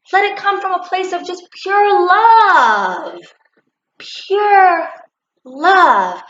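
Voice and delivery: high, tearful voice pleading; tearful plea. Real, not acted. Speaker to listeners, pleading tearfully for love. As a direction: cry out for pure love.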